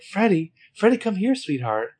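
put-on high-pitched mother calling a child over; Jessica. Mother calling her kid from behind the camera, high imitated call. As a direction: call out lightly, high, easing down on the endearment. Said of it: "hear Jessica calling out from behind the camera".

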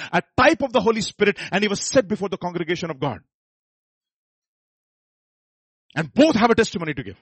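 loud, high preaching, driving every phrase; full-force preaching. Acted. Pastor urging the congregation at full force, loud and high. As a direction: thunder it out to the congregation.

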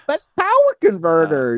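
high whiny Luke imitation; squeaky whiny voice. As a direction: whine it like a petulant teen. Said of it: "with the squeaky voice".

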